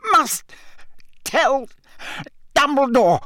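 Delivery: very high, strained, word by word; terrible effort. A narrator's reading. Mad, exhausted man begging, every word an effort. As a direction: force out each word with long gaps. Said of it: "Every word he spoke seemed to cost him a terrible effort".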